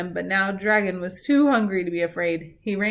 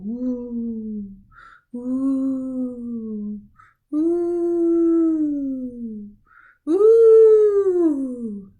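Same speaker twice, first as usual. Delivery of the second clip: woo rising higher and louder; wind howling; 4 semitones higher, 5 dB louder, much slower than usual. A narrator's reading. Wind sound effect.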